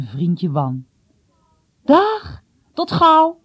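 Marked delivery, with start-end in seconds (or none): 1.8-3.4 high, loud farewell shout; calling out. A narrator's reading. Boy on shore to his friend aboard, calling out. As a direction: call goodbye across the water. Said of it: "riep hij".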